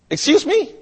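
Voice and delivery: startled, high-pitched excuse me; incredulous cashier. Retold or imitated. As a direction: voice jumps up in disbelief. Cashier reacts in disbelief.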